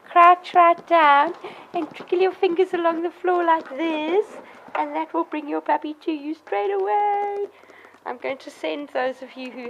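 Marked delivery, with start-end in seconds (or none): 0.1-7.5 raised, high singsong instruction; puppy-coaxing voice. Real, not acted. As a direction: coax a puppy while you explain. Coaxing puppy while instructing owners.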